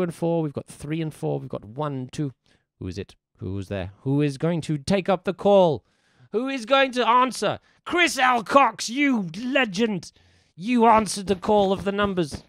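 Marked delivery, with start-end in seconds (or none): 4.0-12.4 higher, louder, hammed-up herald; grand proclamation. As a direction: announce the hero who answered the call. Grand call for an answer.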